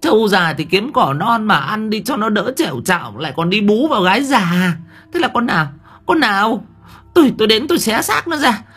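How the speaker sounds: scolding wife. A narrator's reading. Wife pulls husband's ear, accusing him of a mistress, shrill scolding. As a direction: rip into the cheating husband at full pitch. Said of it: "nhéo tai ông Hải chì chiết".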